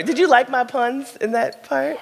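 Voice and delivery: ordinary talk, nothing marked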